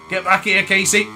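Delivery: calling out. Real, not acted. Gamer to rival rider ahead, calling out. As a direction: loudly call a rival back.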